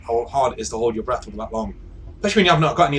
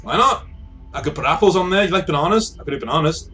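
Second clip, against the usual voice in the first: high, keen wheedling; eager bargaining; 6 semitones higher than usual. Acted. Device offering fruit to be allowed a tree, eager bargaining. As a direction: pitch it up, offering treats.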